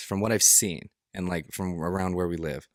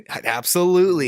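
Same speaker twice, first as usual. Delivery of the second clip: loud, high, hammed-up assent through laughter; mock-fervent agreement; 10 semitones higher, 8 dB louder than usual. Joining friend's bit as a guru's fan, hammed-up enthusiasm. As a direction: burst out as a mock convert. Joins in as the won-over audience.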